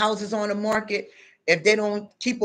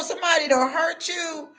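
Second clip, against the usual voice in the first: normal talking voice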